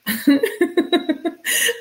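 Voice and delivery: high-pitched real giggle; laughing. Real, not acted. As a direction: run of helpless high giggles. Laughs at herself mid-reading.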